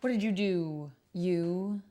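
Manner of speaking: ordinary talk, nothing marked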